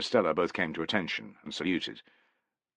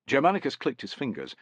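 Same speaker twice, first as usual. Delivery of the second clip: plain reading voice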